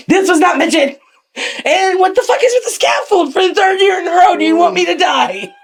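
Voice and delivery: high, strained hollering; hammed-up high-pitched outrage; outraged complaint. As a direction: act out an indignant, squawking protest.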